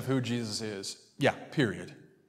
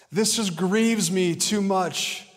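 high, slow, put-upon wail; hammed-up, self-pitying lament, pitched high; acted grief; 7 semitones higher, 4 dB louder, much slower than usual. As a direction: make a big, drawn-out show of being grieved. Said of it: "let me read it in that language".

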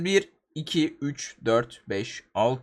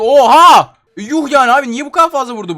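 loud, high gasp of disbelief; shocked exclamation; 7 semitones higher, 11 dB louder than usual. Real, not acted. Gamer stunned by huge damage hit, loud gasp. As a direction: blurt it out in shock.

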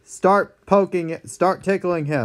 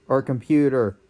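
ordinary talk, nothing marked

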